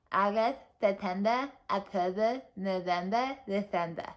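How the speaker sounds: days and months said with the tongue out; tongue stuck out. Shown as a demonstration. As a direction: say it with your tongue all the way out. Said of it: "stick it out".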